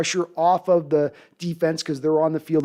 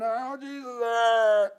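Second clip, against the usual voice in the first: mock pained vampire cry; a hammed-up burning vampire; yelping like a vampire on fire; 4 semitones higher, 5 dB quieter, much slower than usual. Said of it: "he's going".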